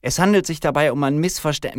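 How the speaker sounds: high, loud official explanation; policewoman addressing crowd. Acted. Policewoman speaking until cut off.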